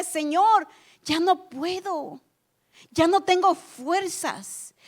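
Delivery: slow, soft, worn-out plea; weary despair. Pretend. Believer telling the Lord he has no strength left, slow and spent. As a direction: let the words sag with exhaustion. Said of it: "un grito de desesperación".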